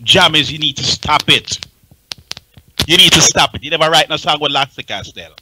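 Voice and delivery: ordinary delivery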